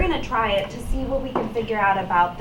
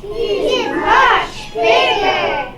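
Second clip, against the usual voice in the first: higher-pitched goat character; lightly voiced billy goat; 9 semitones higher, 6 dB louder, much slower than usual. Goat bargains with the troll, lightly voiced goat. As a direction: plead a little higher, drawing out the end.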